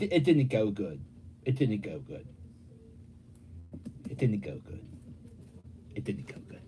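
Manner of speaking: low, dejected repeat dwindling to a whisper; fading to whisper. Real, not acted. Repeats bad date outcome, fading.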